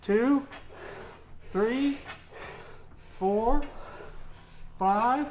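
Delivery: excited counting. Real, not acted. Coach counting final reps.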